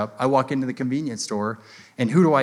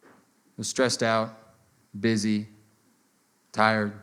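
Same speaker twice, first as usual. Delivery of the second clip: low, subdued officer apologizing; imitated contrite cop, lower-pitched; apologetic cop; 5 semitones lower, 3 dB quieter than usual. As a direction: confess it humbly, with pauses between excuses. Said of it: "I just wanna apologize for my conduct".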